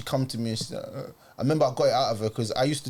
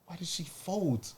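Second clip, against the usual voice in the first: much softer, near whispered inner thought; hushed aside; 9 dB quieter than usual. Retold or imitated. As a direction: say it to yourself under your breath. Inner reaction aside during phone call.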